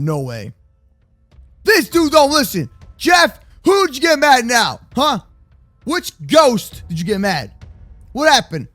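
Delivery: ordinary talk, nothing marked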